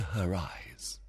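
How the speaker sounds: scheming whisper. Acted. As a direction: breathe it secretly. Plotting, unvoiced.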